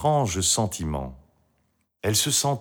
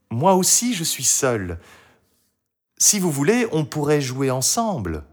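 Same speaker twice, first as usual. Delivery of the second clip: put-on high girl's voice, easing down; Marie; 6 semitones higher than usual. A narrator's reading. Marie invites the lonely dragon to play, high and friendly. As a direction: offer company in a child's voice.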